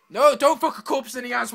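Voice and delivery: loud protest. Real, not acted. Shouting at another player.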